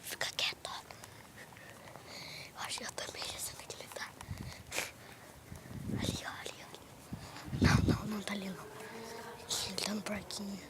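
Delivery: hushed unvoiced whisper; whispering while hiding. Real, not acted. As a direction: whisper so he won't hear.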